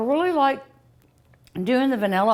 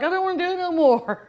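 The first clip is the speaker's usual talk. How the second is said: higher, quick mock complaint; mock whining; 9 semitones higher, faster than usual. Retelling kids tired of making ice cream, mock whining. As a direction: imitate a fed-up kid griping, pitched up. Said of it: "You're like".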